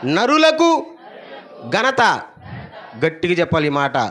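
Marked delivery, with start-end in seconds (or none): 0.1-2.2 louder, slow, higher congregational call. Real, not acted. Preacher leading the crowd to repeat the verse, loud and slow. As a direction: lead the verse out strongly, word by word. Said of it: "ఓ, గట్టిగా చెప్పండి".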